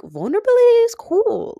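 high, loud declaration; emphatic. Real, not acted. To podcast listeners, proclaiming loudly. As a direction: shout it like a rallying cry.

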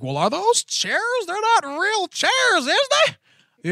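high, slow mock skeptic voice; squeaky mock voice asking if the chairs are real; mocking doubter. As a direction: sneer it like a know-it-all doubter. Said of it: "to everybody out there who's like".

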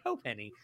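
"Oh, Penny" said as Santa's "ho" plus "penny"; "Oh, Penny" as two words, ho and penny. Shown as a demonstration. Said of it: "what Santa says plus Penny".

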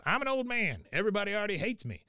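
put-on high, quick old-man voice; Cotton. Cotton offers to confess for Bobby, high insistent voice. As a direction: pitch it well up, speeding up. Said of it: "He goes".

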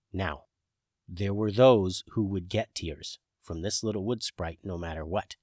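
usual speaking voice

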